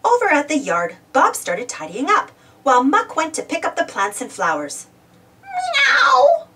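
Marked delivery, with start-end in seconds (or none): 5.4-6.5 high, drawn-out cat miaow; a put-out cat complaining; grumbling cat. A narrator's reading. Pilchard the cat grumbling at the dust. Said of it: "grumbled Pilchard".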